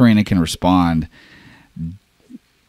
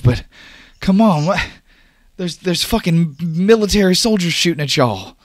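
agitated and high-pitched, urgent; exasperated, worried Oscar; 12 semitones higher than usual. Acted. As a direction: plead with a friend to see sense. Plays Oscar arguing with Dash.

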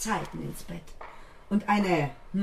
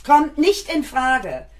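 louder, emphatic, slow start then rushing; firm angry refusal; 5 semitones higher, 8 dB louder, slower than usual. Acted. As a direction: slam the door on the idea. Mother rejects absent father angrily.

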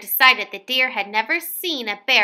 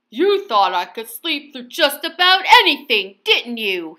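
louder teasing bear; louder gloating Mister Bear; booming bear; 5 dB louder than usual. A narrator's reading. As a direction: gloat over the trick, booming. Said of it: "he boomed".

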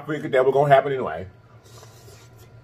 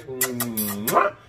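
blown kiss; 2 semitones lower, 7 dB quieter than usual. Real, not acted. Blowing kisses to viewers.

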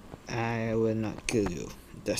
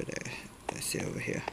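soft unvoiced whisper to himself; 11 dB quieter, much faster than usual. Real, not acted. Gamer sneaking through the area, whispering. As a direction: hush it as you creep forward.

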